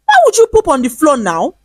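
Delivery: high, loud outraged yell; screaming. A narrator's reading. Wife yelling at husband about the floor, loud and high. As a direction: scold him at full pitch. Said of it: "she screamed".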